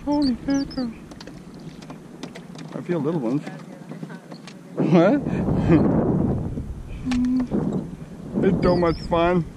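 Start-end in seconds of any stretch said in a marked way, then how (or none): 0.0-0.9 high, soft holy mackerel; pitched-up amazed exclamation; surprised exclaim. Real, not acted. Exclaiming at fish. 5.3-8.4 airy giggle under the breath; breathy laugh. Real, not acted. Laughing at friend's question.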